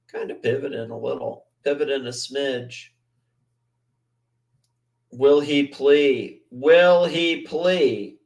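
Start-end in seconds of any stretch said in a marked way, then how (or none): 5.1-8.2 slow, drawn-out, ominous question; slow dramatic question. Pretend. Streamer teases the lawyer video, slow and drawn out. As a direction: stretch every word like a trailer voice. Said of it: "slow dramatic question".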